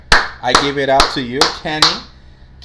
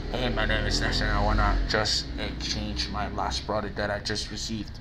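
low-pitched quiet customer; hushed, low phone voice; speaking low; 7 semitones lower, 5 dB quieter than usual. Acted. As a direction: murmur it low, like a caller who is hard to hear. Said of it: "él está hablando bajo".

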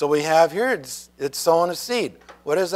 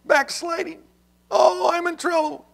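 much higher, fretful worried brother; anxious brother; 12 semitones higher than usual. Retold or imitated. Brother fretting to John Wesley that he's backslidden, high and anxious. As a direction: whine it like a man sure he's lost. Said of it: "He goes".

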